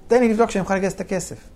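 high, rushed, flustered reply; mock panic, words tumbling out high and fast; flustered stammering. Buyer stalling to raise the money, flustered high rush. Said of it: "התחלתי לגמגם".

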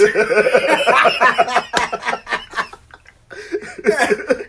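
long, loud, high laughter; real roaring laugh; big laugh. Real, not acted. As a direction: crack up at the punchline. Laughing at judge's joke.

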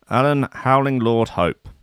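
normal talking voice

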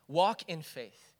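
usual speaking voice

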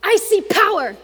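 loud, high, punchy hype man; fired-up hype. Retold or imitated. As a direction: pump him up with every line. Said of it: "So he goes".

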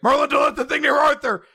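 high, loud mock alarm; panicked warning. Character warns Merlin about the thing near Arthur, mock panic. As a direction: yell an urgent warning at high pitch.

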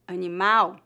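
"animal" as a-ni-MAU, stress on the last syllable and the final L said like U; Portuguese pronunciation. Shown as a demonstration. Teacher models the Portuguese word for learners to repeat, clear Portuguese pronunciation. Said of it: "a letra L é pronunciada como um U".

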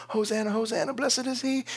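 high-pitched imitation of a cheering crowd; mock crowd screaming. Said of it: "they're all screaming".

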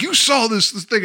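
high-pitched mock indignation; retold mad outburst. Mayor goes after the kid, mock mad. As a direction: imitate someone getting mad, voice jumping up. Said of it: "he gets mad".

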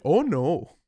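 country Canadian "oh, no" with long vowels; stuck in a country Canadian accent. Shown as a demonstration. Host who can't turn it off, in a country Canadian accent. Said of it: "I can't turn it off".

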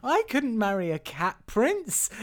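high, drawn-out protest; slow, high-pitched mock indignation; dramatic movie heroine. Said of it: "great line in this scene where she goes".

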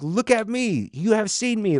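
high, emphatic appeal; exasperated insistence. Acted. Jesus urging disciples to look at him, exasperated. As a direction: raise the pitch and hit each word hard. Jesus voiced demanding to be recognised.

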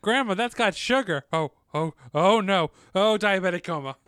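a touch of hammed-up alarm; lightly acted panic over the sugar; mock panic. Acting out the movie's grandma scene, mock panic.

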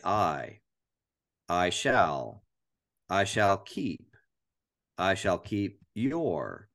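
slow build, adding one word at a time; slowly stack up "I shall keep your" word by word. Shown as a demonstration. Man memorizing a verse, slowly building it word by word. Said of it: "emphasizing each word as I go".

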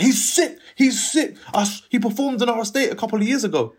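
big him up to the crew; hyped praise. Retold or imitated. Ringleader praising him to the gang, an excited high shout.

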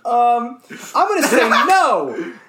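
slow, high, laughing no; can't keep a straight face; giggling refusal; laughing answer. Real, not acted. Laughing answer to absurd question.

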